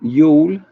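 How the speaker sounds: Yule said loud with a rising, questioning end; failed y'all attempt. Shown as a demonstration. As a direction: try Yule for y'all, with an oo vowel. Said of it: "I can't say that".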